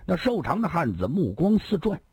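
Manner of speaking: ordinary delivery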